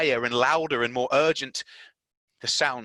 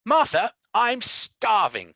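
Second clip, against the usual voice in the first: high, loud call, drawn out slow; Mary calling to the maid, loud and slow; announcing loudly; 13 semitones higher, 8 dB louder, slower than usual. Acted. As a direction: call out you're starving. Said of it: "she announced".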